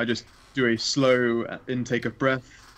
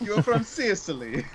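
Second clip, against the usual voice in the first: put-on high, joking Sicilian accent; cartoon Italian pitched up; mock Italian accent; 8 semitones higher than usual. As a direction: ham up the Sicilian, amused. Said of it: "I can't pull an Amy at Italiano".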